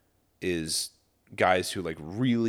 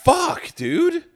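high, loud disbelief; shocked; 5 dB louder than usual. Real, not acted. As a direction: blurt it out in outrage. Shocked [name] only gets the van.

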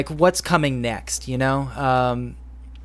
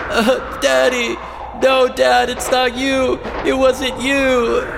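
put-on high, shouted child's wail; hammy anguished cry; 11 semitones higher, 3 dB louder, slower than usual. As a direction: scream it out, denying it to the end. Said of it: "Sounds of Danny sobbing".

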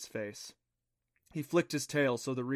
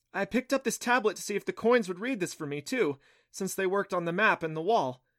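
higher, lightly voiced excited explainer; animated girl character; 7 semitones higher, 4 dB louder than usual. Clancy explaining the talking tablet to Adam, higher animated voice. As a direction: explain in a hurried-sounding higher voice.

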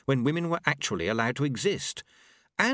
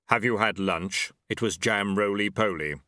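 grim reaper voice; 3 semitones lower than usual. A narrator's reading. Death voiced in story.

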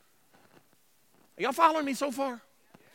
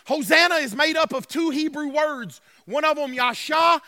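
normal talking voice

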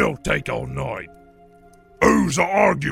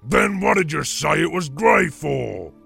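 loud, accusing troll question; angry troll shout; 3 semitones higher, 8 dB louder than usual. Acted. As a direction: demand an explanation at a shout.

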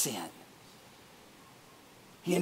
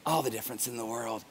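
softer, quicker repeat of the phrase; soft echo; 8 semitones lower, 9 dB quieter, faster than usual. Shown as a demonstration. Teacher softly repeating the point to the class. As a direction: echo it quietly to let it land.

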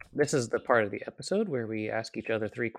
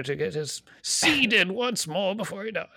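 nature documentary narrator; 9 semitones higher, 3 dB louder than usual. Retold or imitated. Continues nature documentary parody.

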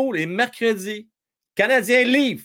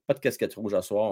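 ordinary talk, nothing marked

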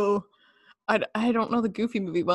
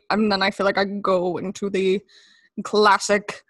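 normal talking voice

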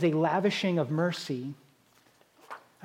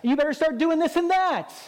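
higher and louder, scolding yell; mock yelling; 9 semitones higher, 3 dB louder than usual. Preacher imitating a gym critic yelling orders, high and loud. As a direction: yell orders at the struggling lifter. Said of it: "they're just yelling at you".

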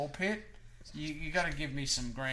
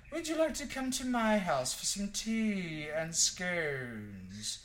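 slow, raised, inviting nice-guy badger voice; polite English badger; 5 semitones higher, 3 dB louder, much slower than usual. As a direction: play a genteel host, drawing out the invitation. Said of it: "He looks like he'd say".

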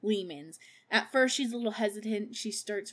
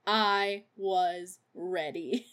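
slow deadpan quote; 2 semitones lower, much slower than usual. Retold or imitated. Quoting Mary's deadpan line.

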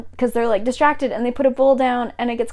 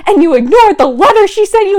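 acted rant; 2 semitones higher, 6 dB louder than usual. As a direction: pile up the accusations, building to a peak. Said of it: "goes on this whole rant".

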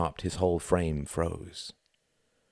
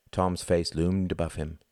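usual speaking voice

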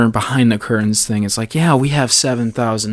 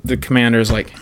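ordinary delivery

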